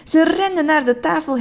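ordinary delivery, nothing marked

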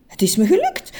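first cheerful cry of success; glad cry. A narrator's reading. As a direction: announce the win with joy. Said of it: "riep meneer Just".

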